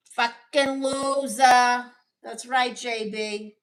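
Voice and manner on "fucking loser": loud insult. Real, not acted. Insulting a chatter.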